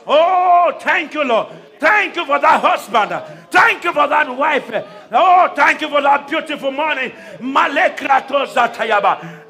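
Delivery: loud, impassioned preacher's prayer; fervent preaching prayer. Acted. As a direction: pray it out at full force, rising. Preacher praying at full force.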